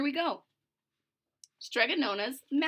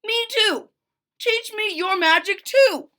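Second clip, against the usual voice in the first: loud, much higher begging; eager Big Anthony; 10 semitones higher, 9 dB louder than usual. Acted. Big Anthony begging the witch, loud and high. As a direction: plead to be included.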